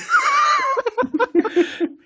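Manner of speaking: breaks into a high loud laugh; loud burst of laughter. Real, not acted. Cracks up mid-reading at own dialect voice, loud laugh.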